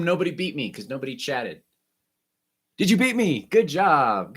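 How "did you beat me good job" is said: excited. Real, not acted. Playful challenge to class.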